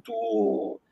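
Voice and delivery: normal talking voice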